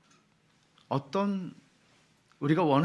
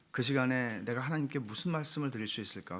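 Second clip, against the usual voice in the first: normal talking voice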